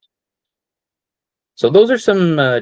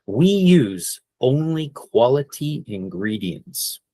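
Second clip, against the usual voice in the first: slow model phrase; 3 semitones higher, slower than usual. Shown as a demonstration. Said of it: "You can use that for restaurants".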